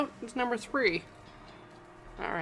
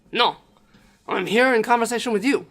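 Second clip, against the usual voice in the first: put-on lightly French-accented detective; reply in a courtly French accent; French gentleman; 4 dB louder than usual. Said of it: "a portly man with a dapper gray suit".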